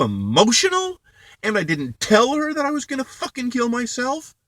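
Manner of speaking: enraged shouting. Real, not acted. Outraged at sister's excuse, screaming in disbelief. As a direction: scream your outrage at her.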